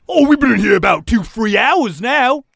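put-on prisoner, stretching the answer out; prisoner character voice. Prisoner answers the guard's question, loud character voice.

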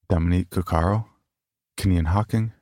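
ordinary delivery

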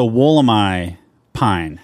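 careful, drawn-out "Wollemi", last syllable rhyming with "eye"; Australian pronunciation. Shown as a demonstration. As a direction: say "Wollemi" ending like "my", slowly. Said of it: "I'm gonna say this correctly".

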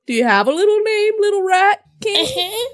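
put-on high baby-talk to a tiny pet; cooing. Player asking a little rat its name, cooing. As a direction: coo it high, stretching the last words.

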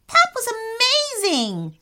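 very high, thrilled exclamation; excited screech. A narrator's reading. Boy praises brother after robot fight, screeching excitedly. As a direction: cry it high with amazement. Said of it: "screeched Rami".

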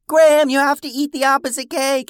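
high, loud call to someone far above; yelling up. Retold or imitated. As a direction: shout it up to a giant son. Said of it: "she yelled up to him".

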